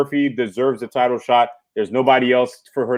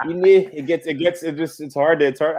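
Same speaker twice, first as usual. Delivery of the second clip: faltering stab at a Scottish accent, higher at first; attempted Scottish accent; 5 semitones higher than usual. Shown as a demonstration. Co-host struggling to show his friend a Scottish accent, faltering attempt. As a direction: attempt Scottish, then give up. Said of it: "I can't even do it".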